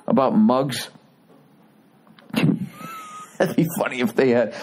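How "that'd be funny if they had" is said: laughing. Real, not acted. Laughs at own joke.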